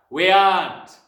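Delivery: very loud, final bellow; curt angry father. A narrator's reading. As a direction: shut down the question with a shout. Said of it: "the father said".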